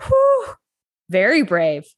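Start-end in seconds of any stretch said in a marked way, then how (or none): 0.0-0.5 whew. Real, not acted. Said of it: "relief after brave question".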